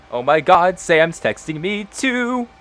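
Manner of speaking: real surprise at a text; surprised. Real, not acted. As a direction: blurt it out, caught off guard. Player surprised by a second text.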